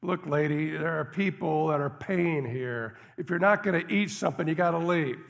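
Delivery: loud, angry cook ordering her out; really loud. Retold or imitated. Diner cook telling the shivering woman to leave, loud and angry. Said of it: "And he got really loud about it".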